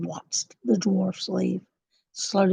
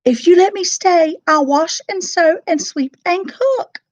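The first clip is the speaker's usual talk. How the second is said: high, bright promising; eager promise; 10 semitones higher, 6 dB louder than usual. A narrator's reading. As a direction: promise eagerly to earn her place.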